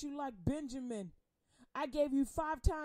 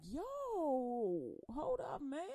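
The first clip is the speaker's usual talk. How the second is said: God stepping in; much slower than usual. Acted. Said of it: "God is like".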